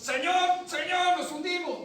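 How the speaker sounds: high, loud frightened plea; desperate shout to wake the master; panicked cry. Acted. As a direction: cry out like sailors going under. Disciples cry out in storm.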